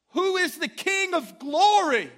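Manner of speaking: James reading in a thick Southern drawl, slow and high. Retold or imitated. Pastor voicing James reading the psalm in a Southern drawl. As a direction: stretch out the Southern drawl, slowly. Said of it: "In a thick Southern drawl".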